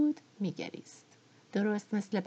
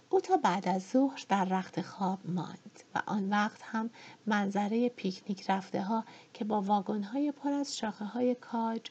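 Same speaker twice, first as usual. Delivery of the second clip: usual speaking voice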